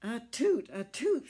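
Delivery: high, loud sneezing toot; squeaky tugboat sneeze. Acted. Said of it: "sneezed Alice".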